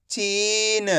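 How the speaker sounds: चीन with a long ee, light final n; stretched pronunciation. Shown as a demonstration. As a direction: hold ची, then release a short न in चीन. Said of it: "मैं इसका खींच के उच्चारण कर रहा हूँ".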